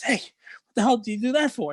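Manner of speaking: high-pitched mock outrage; indignant demand. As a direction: play the affronted man in a joke. Second knocked-down man demands explanation.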